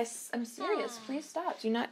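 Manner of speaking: hushed, exasperated please to the cat; quiet pleading. Real, not acted. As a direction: beg the cat to stop, under your breath. Telling the cat off.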